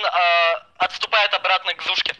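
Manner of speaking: usual speaking voice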